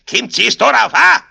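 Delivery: high, bellowed challenge; angry shout. A narrator's reading. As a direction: bellow it in rising Bavarian fury. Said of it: "schrie er". (zorniges Schreien)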